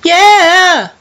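high loud cheer; victory shout. Real, not acted. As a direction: shout in triumph. Cheering level win.

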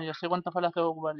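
ordinary delivery

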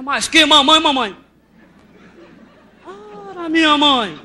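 loud, slow, sing-song outrage; milk each word like a comic catchphrase; exasperated refrain. Acted. Venting to the audience about the boy, loud drawn-out exasperation.

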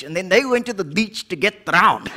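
ordinary delivery

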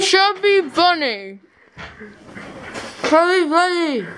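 barely able to talk, cheeks packed; slow, garbled words through marshmallows; cheeks stuffed full. Real, not acted. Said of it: "I don't know if you can understand me now".

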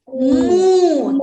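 протяжне, гучне «Му», як у корови; промукай голосно й протяжно, як корова; коров'яче мукання. Shown as a demonstration. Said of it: "Корівка каже". (протяжно мукати, як корівка)

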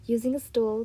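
plain reading voice

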